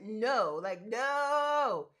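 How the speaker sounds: loud, emphatic no, pitch up; firm no. Real, not acted. To moms on a live stream, a loud firm no. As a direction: slam the word no down. Emphatic refusal to mom pressure.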